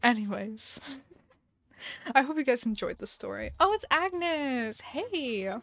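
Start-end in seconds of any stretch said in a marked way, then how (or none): 3.6-5.5 high, drawn-out gushing squeal of greeting; delighted cooing. Real, not acted. Player spotting a cute villager, delighted cooing. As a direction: coo over someone adorable, stretching the words. Gushes on seeing a game villager.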